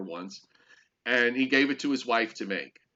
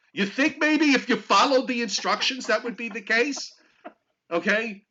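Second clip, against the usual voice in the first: biting sarcastic retort; 9 semitones higher, 3 dB louder than usual. Real, not acted. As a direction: sneer the obvious point. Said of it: "this is a sensitive topic for me".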